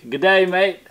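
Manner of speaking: a light Aussie "Good day, mate"; Australian accent. Shown as a demonstration. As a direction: demo the Aussie greeting to the kids. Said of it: "Do you know how to talk in Australian?".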